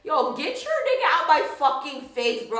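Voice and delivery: acted irate demand; angry friend. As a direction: imitate a friend ready to fight. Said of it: "about to fucking slap this shit".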